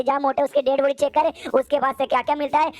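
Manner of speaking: ordinary delivery, nothing marked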